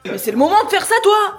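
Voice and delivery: loud reproach. Real, not acted. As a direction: scold him across the room. Reproche à son frère. (reproche fort et exaspéré)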